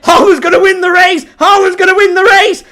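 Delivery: loud, high, rising yell; frantic shout. Real, not acted. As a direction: shout as the chase unfolds. Late chase toward goal.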